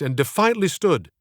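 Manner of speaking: ordinary delivery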